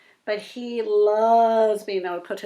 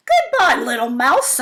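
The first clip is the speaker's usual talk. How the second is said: panicked fox; 2 semitones higher, 8 dB louder than usual. Acted. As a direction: squeal it as he runs off. Fox fleeing.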